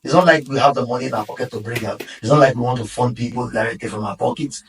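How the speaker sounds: ordinary talk, nothing marked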